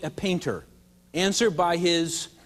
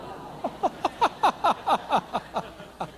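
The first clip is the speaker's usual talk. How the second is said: laugh; 9 semitones higher than usual. Real, not acted. Laughs after the reveal.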